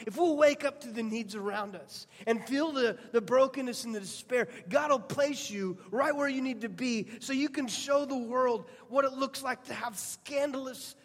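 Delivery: building preacher's fervor; impassioned preaching. Acted. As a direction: rouse the congregation. Preaching builds to full force.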